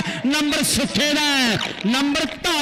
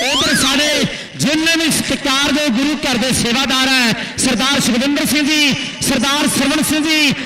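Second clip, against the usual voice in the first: loud stadium play-by-play; live commentary; 5 dB louder than usual. Acted. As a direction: announce it to the whole ground. (ਜੋਸ਼ੀਲੀ ਕੁਮੈਂਟਰੀ)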